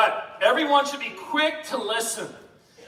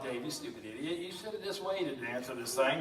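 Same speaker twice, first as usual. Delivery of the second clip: much softer, lower muttered rebuke; self-scolding; 7 semitones lower, 11 dB quieter than usual. Retold or imitated. Dave scolding himself after answering his wife too fast, soft self-rebuke. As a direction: scold yourself under your breath. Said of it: "I'm like".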